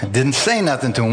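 ordinary delivery

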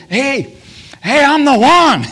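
high, very loud preacher's shout; fired up. Retold or imitated. Preacher imagines Jesus interrupting the feast, fired up. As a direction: burst out to grab the crowd. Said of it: "I believe he got fired up".

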